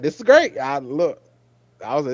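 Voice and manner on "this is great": high, loud happy gush; delighted gush. Retold or imitated. Fan telling her co-host she loved the scene, gushing. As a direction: gush it like a thrilled viewer.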